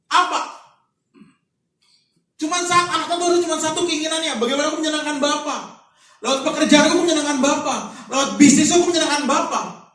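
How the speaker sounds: loud, high preaching push; rally the church with full force; fiery preaching. Acted. Pastor exhorting the congregation, loud fiery preaching.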